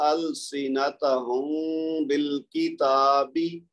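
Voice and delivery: slow, the م of أَلْسِنَتَهُمْ held nasally for two beats; nasal hold on the م of أَلْسِنَتَهُمْ, lips apart, then بِالْكِتَابِ; recited with ghunna. Shown as a demonstration. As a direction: ikhfa demo, slow recitation. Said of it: "یہ میم ساکن ہے اور آگے با متحرک ہے".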